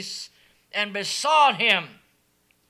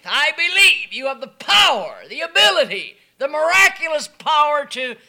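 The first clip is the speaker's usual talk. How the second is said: loud earnest plea; loud believing cry; pleading leper; 3 semitones higher, 9 dB louder than usual. Acted. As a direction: plead with full faith, louder.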